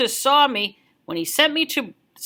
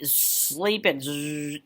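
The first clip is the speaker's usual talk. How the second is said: sleepy snore; 8 semitones lower, 8 dB quieter, much slower than usual. Pretend.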